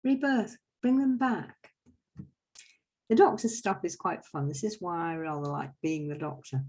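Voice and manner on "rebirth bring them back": a hint of a high declaiming Father Christmas. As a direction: lift the pitch and call it out grandly. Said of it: "But Father Christmas is like".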